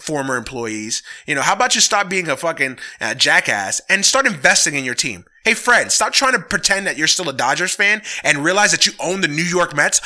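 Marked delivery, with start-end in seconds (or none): none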